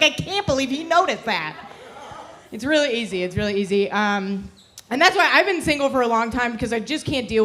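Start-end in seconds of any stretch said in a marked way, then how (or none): 0.0-1.5 higher, delighted imitation; mock thrilled gushing. Comic mimics being flattered about eyebrows, mock gushing. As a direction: act amazed by a small compliment. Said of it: "I'd be like".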